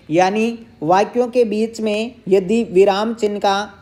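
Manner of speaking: ordinary talk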